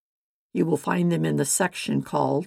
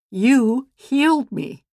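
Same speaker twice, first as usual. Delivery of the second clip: tearful joy; 8 semitones higher, 8 dB louder, slower than usual. Acted. Healed woman tells Jesus.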